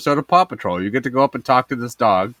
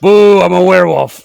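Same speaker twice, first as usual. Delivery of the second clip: louder hammed-up spooky voice; mock spooky boo; 4 semitones higher, 8 dB louder than usual. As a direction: jump out like a werewolf. Spooky werewolf gag.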